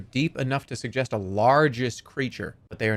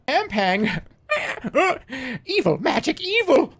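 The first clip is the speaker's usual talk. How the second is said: very high bird voice broken by squawk-laughs; high hawk voice with hiccuping cackles; 13 semitones higher, 3 dB louder than usual. Acted. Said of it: "That's not even a bird".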